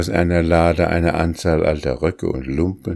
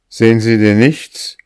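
louder, higher, impatient question; exasperated demand; 5 semitones higher, 7 dB louder than usual. A narrator's reading. Master to the dull housekeeper, louder exasperated question. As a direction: press her, unable to believe she sees nothing.

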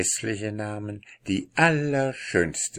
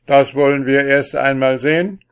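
loud, higher, gruff cut-in; gruff interruption; 6 semitones higher, 10 dB louder, faster than usual. Acted. The king interrupts Pechvogel, gruff and loud. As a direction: cut him off roughly. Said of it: "unterbrach ihn der König barsch".

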